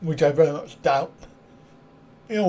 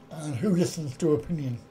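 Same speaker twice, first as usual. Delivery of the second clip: usual speaking voice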